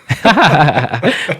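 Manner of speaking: bright rising laugh at own joke; a quick laugh climbing up. Real, not acted. Laughing at her own rhyming birthdate joke, a rising laugh.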